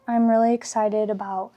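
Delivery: ordinary talk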